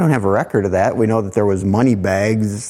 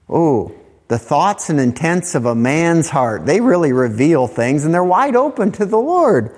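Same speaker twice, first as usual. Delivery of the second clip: normal talking voice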